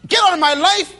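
loud, sharp dismissal shouted down the phone; harsh brush-off. Retold or imitated. Pastor acting a husband dumping a sweetheart, loud and cold. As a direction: slam the door on her with your voice. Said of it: "You gotta pick up the phone and say".

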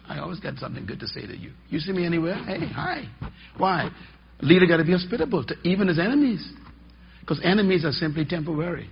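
ordinary talk

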